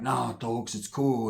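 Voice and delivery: low, soft, easy American talk; American accent. Retold or imitated. Toko reassuring his friend, relaxed American accent. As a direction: keep it American, low and casual. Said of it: "he talks like American".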